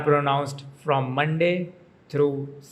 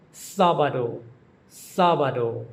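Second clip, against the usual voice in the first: "sábado" with the weight on SÁ; SÁ-ba-do, "sábado" falling away after the stress; stress on first syllable. Shown as a demonstration. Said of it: "emphasis on the first syllable".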